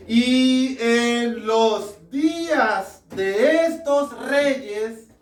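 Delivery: "días de estos reyes" drawn out word by word; very slow, stretched stress on "estos reyes"; emphatic scripture reading. Real, not acted. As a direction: read it slowly, underlining "estos reyes". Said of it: "destaque eso".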